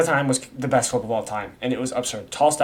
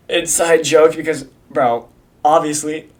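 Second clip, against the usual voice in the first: ordinary talk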